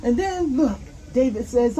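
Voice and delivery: ordinary delivery, nothing marked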